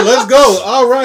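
loud, high-pitched mock hype through laughter; rowdy joking excitement; playful hyping. Playfully hyping up the chair joke.

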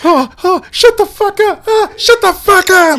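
put-on high whiny childish voice; whiny kid voice. Friend mocking a child tattling, high whiny. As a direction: whine it like a wounded little kid.

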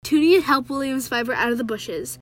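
put-on lively read-aloud of a children's novel; story reading. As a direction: read the chapter to your viewers with energy. Reads book excerpt aloud.

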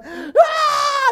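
high, loud scream imitating a panicked child; mock panicked scream. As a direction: act out a sudden shriek of alarm. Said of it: "I started screaming".

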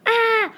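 short, loud, high-pitched scream. A narrator's reading. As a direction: scream in alarm. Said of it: "Ralph screamed".

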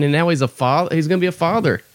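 ordinary talk

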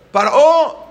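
high, loud, drawn-out call. Retold or imitated. Children calling to Paró from the window, teasing shout. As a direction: holler the name from a window. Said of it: "le gritan por la ventana".

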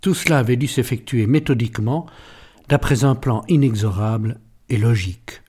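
usual speaking voice

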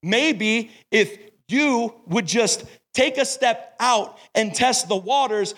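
usual speaking voice

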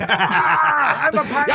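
high, slow mock pirate; pirate cry. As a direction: stretch out a silly pirate line. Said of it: "you yelled out".

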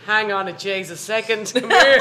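hammed-up Dublin accent, drawn out. Driver confronting the car behind, Dublin accent. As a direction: stretch it out in a mock Dublin accent. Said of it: "it was my Dublin accent".

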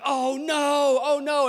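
high, loud, alarmed oh no twice; a driver's flash of panic hitting the brakes; panicked oh no. Retold or imitated. Pastor retelling hitting the brakes, in mock panic. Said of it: "and I'm like".

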